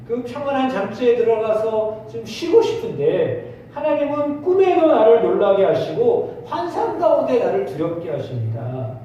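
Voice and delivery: ordinary talk, nothing marked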